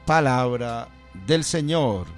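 normal talking voice

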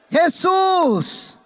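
high, loud call of a name; crying out. Shown as a demonstration. Preacher shows the congregation the blind man's loud cry. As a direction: call out to him with everything. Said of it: "¿Cómo gritaba?".

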